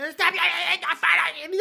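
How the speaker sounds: high-pitched shout to stop; yelled scolding. Acted. Subway bully telling laughing man off, high yell. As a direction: shout over someone's laughter.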